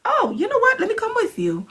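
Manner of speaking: high, bright tagalong enthusiasm; eager. Retold or imitated. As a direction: jump in eagerly to tag along. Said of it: "I'm like".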